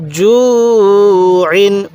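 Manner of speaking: জু held long, ইন short; elongated recitation. Shown as a demonstration. Teacher showing how to read it, long জু, short ইন. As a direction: stretch জু, then close briefly on ইন. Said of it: "এরকমভাবে শিখব".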